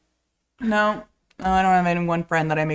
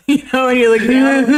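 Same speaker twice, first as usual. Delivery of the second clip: laughing; 5 semitones higher, 8 dB louder, faster than usual. Real, not acted.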